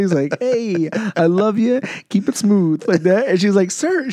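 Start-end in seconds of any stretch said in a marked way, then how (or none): 0.4-2.8 raised, louder tipsy send-off; imitated jovial drunk. Drunk man walking away from the cashier, mock jovial. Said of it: "and he's laughing". 3.7-4.1 high-pitched mock protest; flustered old cashier. Old Publix lady calling after the tipper, mock flustered. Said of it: "trying to get it out real fast".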